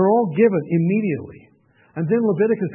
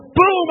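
high, loud mock "boom"; sudden explosion noise; explosion sound; 11 semitones higher, 5 dB louder than usual. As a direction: blurt a fire-strike boom. Imitating fire from heaven.

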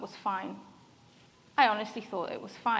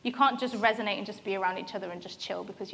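ordinary talk

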